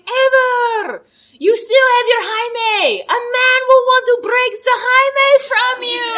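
high, slow, mock-tearful warning; solemn nurse warning. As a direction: plead it like a tragic prophecy. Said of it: "She's like".

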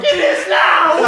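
high, drawn-out shout with laughter; triumphant scare character. Retold or imitated. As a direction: declare it with crazed glee. In character, renaming the victim.

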